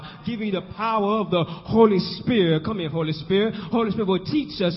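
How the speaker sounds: ordinary delivery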